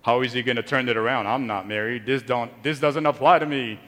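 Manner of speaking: lightly mock a bored teenager who thinks it doesn't apply; bored teen voice. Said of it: "You're like".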